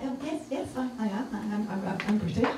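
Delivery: quick, stumbling mock-flustered admission; nervous stammer. Passenger admits nationality to driver, nervous stammer. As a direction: trip over the words apologetically.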